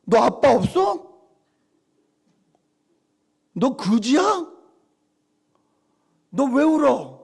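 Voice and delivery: loud, high angry scolding of the crying daughter. Retold or imitated. Father confronting his crying daughter, loud scolding. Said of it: "가서 제가 따졌어요".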